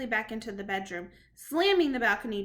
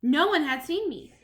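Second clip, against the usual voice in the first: plain reading voice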